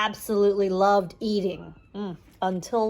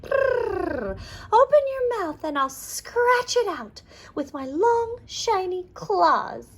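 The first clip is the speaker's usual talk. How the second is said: cat voice; 9 semitones higher than usual. Acted. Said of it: "said Polly the cat".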